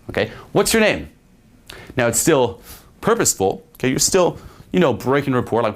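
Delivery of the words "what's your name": high, bright-toned fun question; playful and light. Shown as a demonstration. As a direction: toss it out like a tease. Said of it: "adding to the fun".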